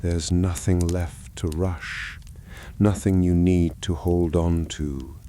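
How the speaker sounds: ordinary delivery, nothing marked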